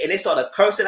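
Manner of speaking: usual speaking voice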